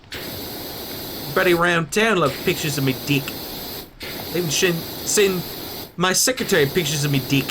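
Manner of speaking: put-on thick New Zealand accent, e vowels bent to i; broad kiwi accent. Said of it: "a very thick New Zealand accent".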